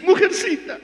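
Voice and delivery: high mock "Mujercita"; lightly acted insult; mocking quote. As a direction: toss "Mujercita" up high and teasing. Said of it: "¿Qué te dice?".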